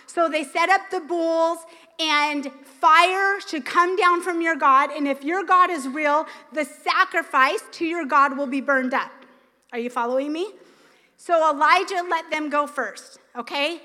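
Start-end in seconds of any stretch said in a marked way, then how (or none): none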